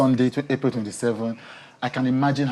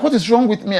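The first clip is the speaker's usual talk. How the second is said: higher and louder, overcome with tears; tearful outburst; 8 semitones higher, 8 dB louder than usual. Retold or imitated. Host reliving her own tearful interview, crying out loud. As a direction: cry it out as if tears are flowing. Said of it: "I'm like".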